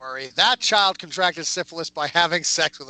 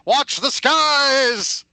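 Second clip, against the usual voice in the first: slower, higher sci-fi trailer shout; dramatic voiceover; 6 semitones higher, 3 dB louder, much slower than usual. Pretend. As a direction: announce the alien threat, drawn out. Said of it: "Wrong voiceover".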